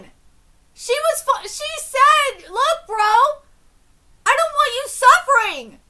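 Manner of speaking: high, loud, exasperated urging; heated pleading. Real, not acted. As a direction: argue hard with someone who won't listen. Heated plea to manga character.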